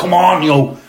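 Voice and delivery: high, loud rallying shout of come on; captain lifting the stadium, loud cheer; rallying cry. Retold or imitated. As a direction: rouse the crowd like a captain. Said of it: "I'm doing it now".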